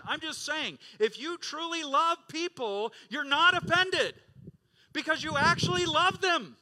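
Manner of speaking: loud, high, driving sermon climax; full-force preaching. Acted. Pastor thundering at his congregation, loud and high. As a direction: preach it out to the whole church.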